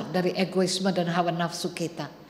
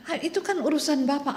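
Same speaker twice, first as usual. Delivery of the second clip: higher and fast, shrugging it off; dismissive clerk; 8 semitones higher, faster than usual. Acted. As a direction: toss it away as not your problem. Clerk dismisses old man. (petugas acuh tak acuh, cepat dan bernada tinggi)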